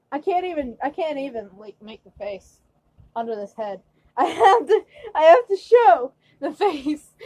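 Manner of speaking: ordinary talk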